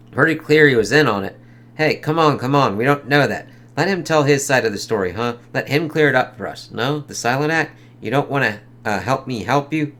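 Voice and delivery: a hint of a tough detective; reading comic dialogue. Reads good cop bad cop.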